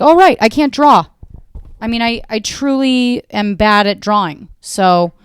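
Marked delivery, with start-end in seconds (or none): 0.0-1.1 loud, deadpan sudden realization; loud self-mocking realization; dry realization. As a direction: say it like it just dawned on you. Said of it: "I was like".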